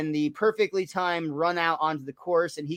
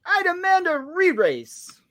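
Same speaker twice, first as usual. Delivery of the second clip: a much higher, slow put-on demand; indignant runner; 12 semitones higher, slower than usual. Host voicing a runner's demand, high, slow put-on voice.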